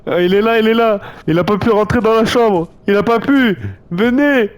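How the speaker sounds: high, loud excited calls; excited shouting. Real, not acted. Monster stuck, excited calling out.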